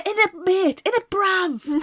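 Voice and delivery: loud, high mock roadman voice; mock London slang. Mocking the slang from the book. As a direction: ham up the bruv accent.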